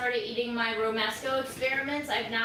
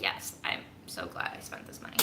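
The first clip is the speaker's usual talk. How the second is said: whispered inner thought; 8 dB quieter than usual. Retold or imitated. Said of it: "now I'm like".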